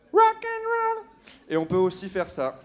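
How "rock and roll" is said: high squeaky rock shout. Acted. Showing rock gesture with gloves.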